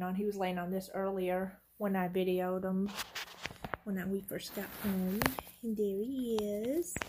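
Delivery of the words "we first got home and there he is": ordinary talk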